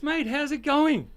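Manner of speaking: high, drawn-out slow hail; cheery greeting. Acted. James greets Harry third time.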